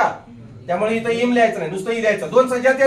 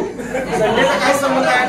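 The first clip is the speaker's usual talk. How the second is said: laughing teasing question; slower than usual. Real, not acted. Teacher laughs at wrong answer.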